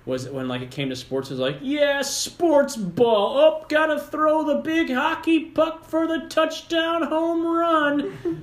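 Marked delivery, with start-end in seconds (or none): 1.6-8.4 high, loud, slow singsong cheer; mock theater major. Theater major faking sports talk, high mocking cheer. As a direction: draw out mock sports enthusiasm. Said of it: "going to a school with theater majors".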